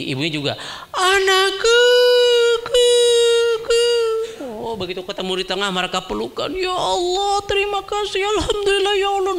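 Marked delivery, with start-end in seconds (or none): 0.9-3.8 high, loud, tearful cry; mother crying out to her found child; mother calling. Acted. 6.5-9.4 high, weeping prayer of gratitude; mother thanking God through tears; crying thanks. Acted.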